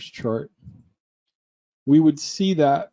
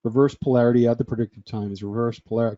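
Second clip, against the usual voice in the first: quick, low rattle-off; fast tongue-twister; 3 semitones lower, faster than usual. Shown as a demonstration. Teacher tries saying the term ten times fast, rapid and low. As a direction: race through it like a tongue-twister. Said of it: "Say that ten times fast".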